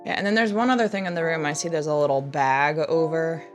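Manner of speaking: usual speaking voice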